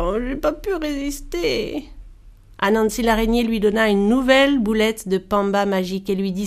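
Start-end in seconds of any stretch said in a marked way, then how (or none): 0.0-1.8 higher-pitched character grumble; grumbled excuse. A narrator's reading. Spirit admitting to the spider he gave in, grumbling. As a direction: whine out the confession in a put-on voice. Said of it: "L'esprit vorace grogna".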